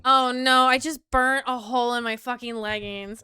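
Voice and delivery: loud, upset exclamation; real dismayed outburst. Real, not acted. Streamer discovering a hole burnt in leggings, loud dismay.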